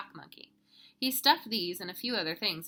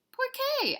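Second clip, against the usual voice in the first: very high, loud squeaky asking; puzzled question; 6 dB louder than usual. Acted. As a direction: pipe up in amazed puzzlement. Scapito asks in surprise.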